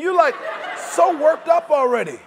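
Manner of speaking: normal talking voice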